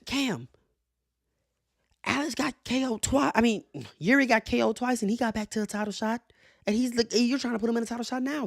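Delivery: high-pitched mimicked complainers; protesting fans. Quoting people who argue with him, high mock protest. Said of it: "a lot of people say".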